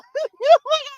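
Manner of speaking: very high burst of laughter. Real, not acted. Laughing at the Afro beats switch, very high. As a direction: crack up.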